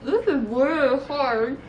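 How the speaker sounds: slow, mushy through a mouthful; cheeks stuffed full. Real, not acted. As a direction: struggle to talk round stuffed cheeks. Mouth crammed with marshmallows.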